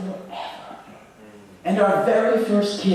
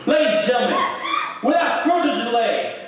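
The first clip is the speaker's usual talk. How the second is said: laughing, higher showman build-up; hammed-up stage introduction; mock announcer; 8 semitones higher than usual. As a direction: introduce her like a grand emcee. Grand introduction of the poet.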